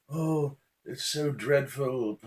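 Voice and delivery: high, slow, drawn-out English lament; mock English accent. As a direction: badly fake a South African accent that comes out English. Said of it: "It's an English accent".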